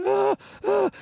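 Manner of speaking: very high mock-panic whimpers; panicked gasps. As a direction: strain and squeal, acting out desperation. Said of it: "and sweat and the panic".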